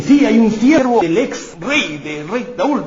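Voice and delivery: high, slow, eager servant's reply; a servant answers King David, high and slow; servant answering. Acted. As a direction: announce it up and slowly to the king.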